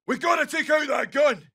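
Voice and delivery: high-pitched shout; rally the squad against a threat; urgent combat order. Acted.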